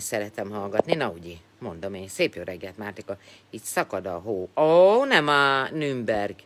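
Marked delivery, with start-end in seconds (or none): 4.6-6.3 drawn-out exclaim. Real, not acted. Reacting to snow news.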